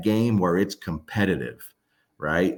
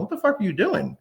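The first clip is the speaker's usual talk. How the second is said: high, exaggerated what-are-you-doing; a high, incredulous scolding of the dog; mock exasperated yell; 12 semitones higher, faster than usual. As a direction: pitch it way up in mock disbelief. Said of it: "She understands me going".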